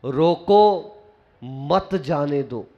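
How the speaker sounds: "रोको", a clear pause, then a low, slow "मत जाने दो"; pause after "रोको". Shown as a demonstration. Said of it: "इसके दो अर्थ लगा लेना".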